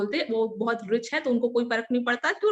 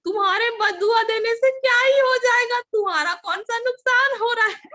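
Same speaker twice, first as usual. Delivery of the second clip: much higher and louder, mocking scorn; imitated scornful shout; 10 semitones higher, 7 dB louder than usual. Uncle mocking her curse, loud scornful shout. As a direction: sneer it back at her at full volume.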